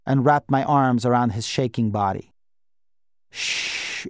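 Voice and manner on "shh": soft hushing sound; quiet a child gently; soothing shush. A narrator's reading. Guardian holding the shaking boy close, soft shush.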